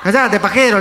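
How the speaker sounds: high, loud mock insult; retold shout. Crowd shutting up a pompous moviegoer, loud mock yell. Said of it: "le gritaron a este".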